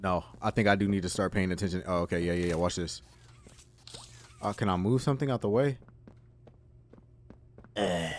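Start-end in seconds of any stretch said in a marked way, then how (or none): none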